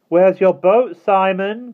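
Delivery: very high, loud taunt; mocking call. A narrator's reading. Withers mocks Simon from the dinghy, taunting. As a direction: sing it out mockingly across water. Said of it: "called the mocking voice".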